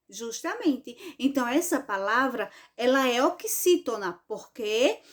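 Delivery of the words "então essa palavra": ordinary talk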